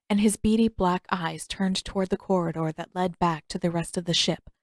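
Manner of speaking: ordinary delivery, nothing marked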